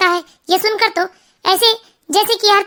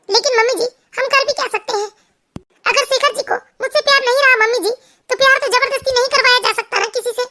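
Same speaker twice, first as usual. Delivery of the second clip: lightly acted, higher-pitched despair; a touch of helpless pleading, pitched up; helpless daughter-in-law; 4 semitones higher than usual. Narrator plays resigned daughter-in-law. (लाचार बहू का हल्का अभिनय)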